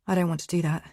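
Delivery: plain reading voice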